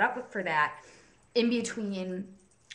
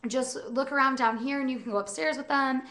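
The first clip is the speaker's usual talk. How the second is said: quoting the first group; 7 semitones higher than usual. Retold or imitated. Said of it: "the first group that I started with was like".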